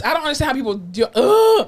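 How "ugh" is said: high, loud groan of disgust; sharp high "ugh" of revulsion. Real, not acted. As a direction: let out a revolted ugh. Reacting to unwashed people.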